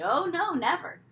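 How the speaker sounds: sarcastic mock. A narrator's reading. Girl mocking mother's perfect childhood, high and sarcastic. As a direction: roll your eyes through it.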